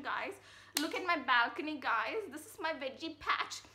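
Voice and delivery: loud, beaming, boastful house tour, laughing as she goes; proud homeowner showoff. Pretend. Imagining her house tour for her viewers, loud and gleeful. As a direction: show off every room like a thrilled new owner. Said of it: "I'll be like".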